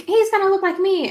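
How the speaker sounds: high, loud playful insistence; insistent. Retold or imitated. Telling Tony whom the baby will resemble, high proud insistence. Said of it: "'cause I'm like".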